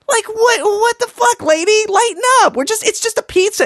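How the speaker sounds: high, incredulous outburst at the mom; exasperated rant. Acted. Ranting at the mom about the pizza, high and incredulous. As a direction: squeal it in disbelief.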